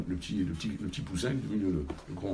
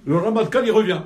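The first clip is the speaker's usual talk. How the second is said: much higher and louder, building tension; dramatic announcement; 11 semitones higher, 13 dB louder than usual. A narrator's reading. Storyteller tells the audience the general is back, dramatic. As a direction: announce the villain's return with a big voice.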